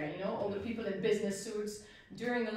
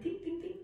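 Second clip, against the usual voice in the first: higher voiced imitation of coins landing on pavement; coin clinks imitated; 7 semitones higher, 3 dB quieter than usual. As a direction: chime the little coin clinks lightly. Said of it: "You hear, like".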